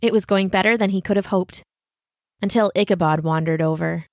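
ordinary delivery, nothing marked

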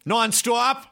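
put-on high, thick Boston-accent driver; exaggerated Boston accent, pitched high; caricatured Boston accent. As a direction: imitate a cartoonishly Bostonian cabbie. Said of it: "the most caricaturized Boston accent I've ever heard".

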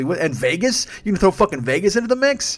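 mock-outraged rising questions; incredulous. Real, not acted. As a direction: pitch climbing in comic disbelief. Incredulous rant, piling on absurdity.

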